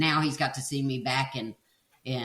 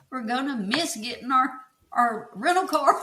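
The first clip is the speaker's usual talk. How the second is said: high, amused imitation of a worried husband; mock urgency; 10 semitones higher, 3 dB louder than usual. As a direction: retell his hurrying plea, pitched right up. Retold husband urging her to hurry.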